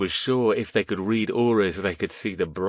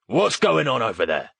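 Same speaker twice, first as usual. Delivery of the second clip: authoritative; 4 semitones higher, 3 dB louder than usual. A narrator's reading. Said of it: "older, authoritative".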